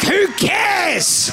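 high, dismissive mock shout; petulant retort. Snapping back at God about farming, high petulant shout. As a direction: yell back like a sulky student.

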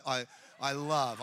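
ordinary talk

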